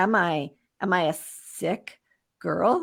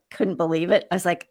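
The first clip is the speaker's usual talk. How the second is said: ordinary delivery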